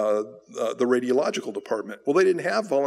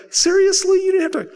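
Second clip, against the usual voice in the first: much higher, louder squeaky incredulous "Seriously?"; mock disbelief; 5 dB louder than usual. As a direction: act stunned that he got it for free. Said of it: "I'm just like".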